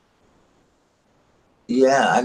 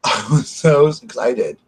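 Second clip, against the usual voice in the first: ordinary talk, nothing marked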